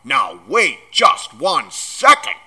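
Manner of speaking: loud, slow, outraged demand; thundering anger. A narrator's reading. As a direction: hammer out each word, loud. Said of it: "Hagrid thundered".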